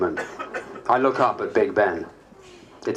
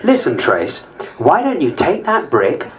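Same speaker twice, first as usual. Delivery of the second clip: sarcastic retort; 9 semitones higher, 7 dB louder than usual. Pretend. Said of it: "I wanted to say to her".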